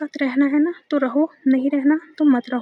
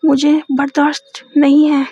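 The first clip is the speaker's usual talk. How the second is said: angry comeback; 3 semitones higher, 9 dB louder than usual. Retold or imitated. Girlfriend scolding Maysha for her tone, furious and loud. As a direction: rebuke her sharply, offended. Girlfriend fires back in a fight.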